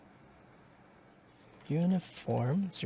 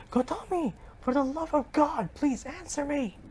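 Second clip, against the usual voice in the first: pleading cry; 13 semitones higher than usual. Acted. Begging for an answer in fire.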